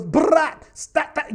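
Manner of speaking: hint of a stern British scold; mock British command; British accent. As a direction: put on a British accent, sharp and loud. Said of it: "the same British dude that goes".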